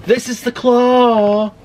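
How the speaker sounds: slow, high amazed reveal; wide-eyed wonder; awed again. Real, not acted. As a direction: stretch out the words in awe.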